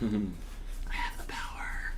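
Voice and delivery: very slow, low whisper; mock-power whisper. Joking about controlling someone's voice assistant, slow whisper. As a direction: whisper it slowly like a secret claim to power. Jokes about commanding listener's device.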